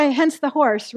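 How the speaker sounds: usual speaking voice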